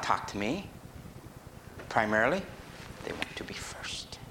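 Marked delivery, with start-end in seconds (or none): 3.1-4.2 breathy hushed whisper; whispered like an eager child. Retold or imitated. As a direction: drop to a secret whisper. Mimicking children wanting first.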